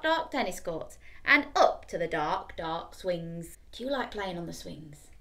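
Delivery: plain reading voice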